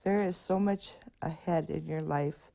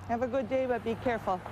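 cheery send-off; 6 semitones higher, faster than usual. Real, not acted. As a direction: wish them well as they cross. Sending kids off at crossing.

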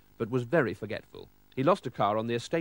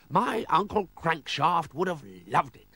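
higher-pitched theatrical aristocrat; admiring lord; 5 semitones higher than usual. Acted. Sir Marmaduke admiring the car, high lordly voice.

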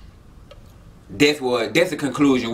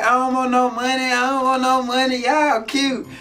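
lightly higher-pitched woman's voice; imitated woman; 9 semitones higher than usual. Woman turning down money from the two men, imitated high. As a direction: mimic her reply in a raised pitch.

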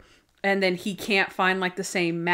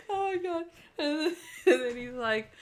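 high, soft, spent from laughing; real laughter aftermath; 7 semitones higher, 7 dB quieter than usual. Real, not acted. Podcaster recovering from her own story, still laughing. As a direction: catch yourself after a laughing fit.

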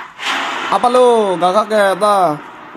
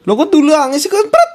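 annoyed outburst; 7 semitones higher than usual. Real, not acted. Level restarts, annoyed.

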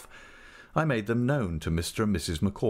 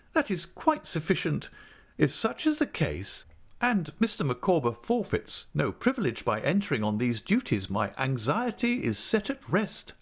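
higher, satisfied lady's voice; relieved lady, pitched up; lightly voiced lady; 9 semitones higher than usual. Mrs Micawber voice.

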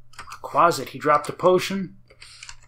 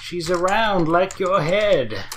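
put-on slow, drawn-out Italian accent; mock Italian accent; 3 semitones higher than usual. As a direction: stretch it out in a playful Italian accent. Repeats the put-on Italian accent bit.

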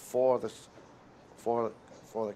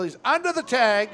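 high, loud call of a close steal; excited play call; 10 semitones higher, 7 dB louder than usual. Real, not acted. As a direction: call it urgently as the runner slides in. Calling the steal live.

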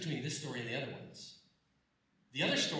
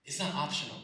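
normal talking voice

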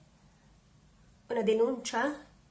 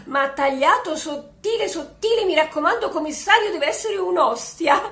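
excited insistence; 4 semitones higher, 6 dB louder than usual. Acted. Orders thin-sliced ham, giggling.

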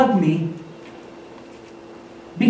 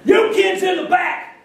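platform shout; 9 semitones higher than usual. Retold or imitated. Camp speaker scolding kids in the back, high imitated shout. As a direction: imitate a speaker hollering at the crowd. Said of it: "he got up on the platform".

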